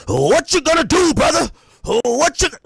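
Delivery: loud, high hammed-up wrestler shout; mock a pro wrestler promo; booming wrestler boasts. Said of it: "Ditch your wrestler voice".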